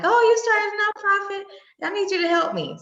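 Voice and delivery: high, loud eager caller; mocking a caller asking for help. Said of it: "everybody calls and like".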